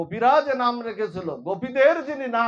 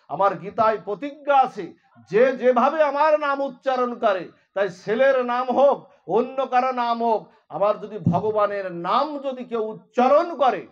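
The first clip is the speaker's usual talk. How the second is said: louder, emphatic preaching, laughing in places; preaching at full force; 2 semitones higher, 6 dB louder than usual. Acted. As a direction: drive home the glory of the name. Preaching the glory of the name. (জোরালো প্রবচন)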